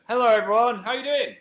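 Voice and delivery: loud, high sing-song hello; chirpy hello to everyone watching; excited greeting. Real, not acted. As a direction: beam out a big welcome. Greeting viewers again, cheerfully.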